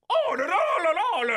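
high loud gibberish yell with an Italian flavor, hammed-up; mock Italian yell. Asking if Mario yells Italian style, high mock yell. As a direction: yell nonsense like a cartoon Italian. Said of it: "is it an Italian yell".

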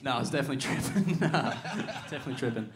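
talking through a laugh. Real, not acted. Laughing at own nerves.

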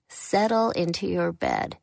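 plain reading voice

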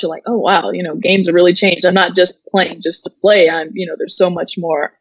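normal talking voice

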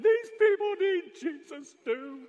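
high, loud, drawn-out mock wail; imitated crying child. Father imitating his crying son protesting the move, wailed. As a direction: wail it like a distraught kid. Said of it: "He was crying. I was crying".

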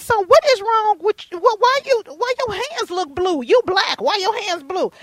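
worried mama scolding in disbelief; mother shocked at her son's icy hands; alarmed mom. Retold or imitated. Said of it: "And I said".

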